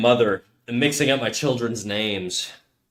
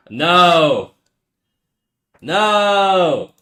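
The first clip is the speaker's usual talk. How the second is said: shocked no; 6 semitones higher, 6 dB louder than usual. Real, not acted. Shocked by the posted picture.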